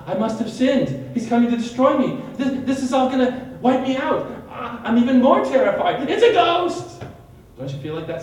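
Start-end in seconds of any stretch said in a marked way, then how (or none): 0.0-6.1 higher, louder, panicky inner voice; rising mock dread; imitated panic. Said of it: "you're like". 6.1-7.0 much higher and louder, panicked; mock-terrified shriek; imitated scream of fear. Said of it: "you're like".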